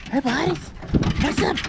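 high, happy greeting to the dogs; excited dog greeting. Real, not acted.

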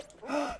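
sharp high gasp; quick startled intake of breath; shocked gasp. Real, not acted. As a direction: gasp in sudden alarm. Sudden shock in the game.

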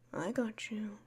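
soft unvoiced reassurance; whispered comfort. Whispering to a crying friend, I got you. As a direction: breathe it close to their ear.

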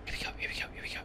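tense breathy whisper. Real, not acted. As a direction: whisper it while hiding. Hiding from the killer, tense.

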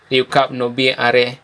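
ordinary delivery

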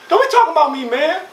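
put-on high indignant kid's retort. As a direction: snap back like an offended boy. [name] retorting in a quarrel.

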